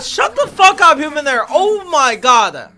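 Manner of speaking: ordinary talk, nothing marked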